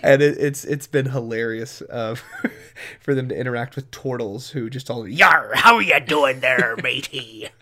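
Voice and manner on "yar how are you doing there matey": louder pirate accent, a rolling "Yar" up front; hammed-up pirate greeting in a pirate accent. Shown as a demonstration. Game master voicing pirate turtles greeting players, pirate accent. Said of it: "glad you just went for it".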